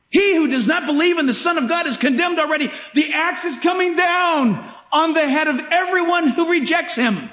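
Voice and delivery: high, ringing preacher's cry; fiery pulpit shout; preaching at full force. Acted. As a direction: bring the axe down with your voice. Preaching peak, high and far.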